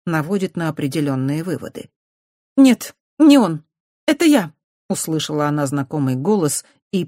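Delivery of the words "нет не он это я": put-on young girl's voice. A narrator's reading. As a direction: own up awkwardly. Dukati confessing sheepishly. (высокий девичий голос)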